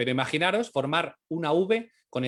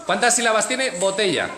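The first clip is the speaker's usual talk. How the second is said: teacher voice to class; 8 semitones higher, 7 dB louder than usual. Real, not acted. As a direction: call the class to attention, lively. Teacher leading the class on video.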